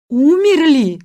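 high, loud disbelieving query; loud echoed question. A narrator's reading. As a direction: throw the word back loudly. Shouting at sleeping boys.

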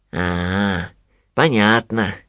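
drawn-out, pensive bear; thoughtful drawl. A narrator's reading. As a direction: let it trail slowly, thinking it over. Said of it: "задумчиво протянул Винни". (задумчиво, протяжно)

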